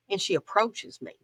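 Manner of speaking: ordinary delivery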